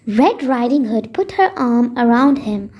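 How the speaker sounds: usual speaking voice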